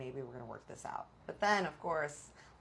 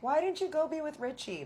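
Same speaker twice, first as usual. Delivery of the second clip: mock audience cry; 12 semitones higher, 4 dB louder than usual. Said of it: "everyone's screaming".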